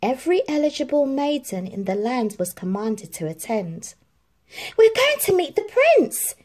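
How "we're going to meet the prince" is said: much higher, loud squealing stepsister; excited yell. A narrator's reading. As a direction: shriek it like a giddy spoiled sister. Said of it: "yelled Drizella".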